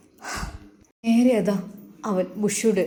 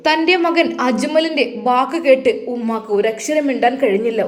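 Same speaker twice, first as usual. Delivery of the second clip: plain reading voice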